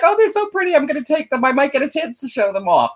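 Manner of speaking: high, excited gushing; loud, high delighted self-quote. Retold or imitated. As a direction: squeal over the pretty socks. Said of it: "But I was like".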